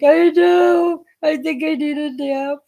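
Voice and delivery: high, wobbly giddy voice; giddy and teary. Retold or imitated. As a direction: admit it between laughs. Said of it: "I'm like".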